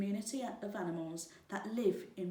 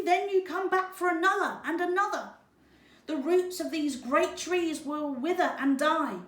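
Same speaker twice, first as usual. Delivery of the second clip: much higher and louder, fast excited monkey chatter; 9 semitones higher, 9 dB louder than usual. Acted. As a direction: chatter urgently like a troop of monkeys. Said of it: "They chattered".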